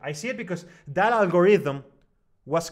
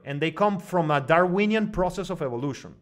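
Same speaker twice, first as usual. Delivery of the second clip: ordinary talk, nothing marked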